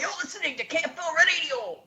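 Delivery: high-pitched Scottish radio ident; Scottish accent. Shown as a demonstration. Host showing how the DJ introduces the station, high Scottish accent. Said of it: "You gotta do the Scottish accent".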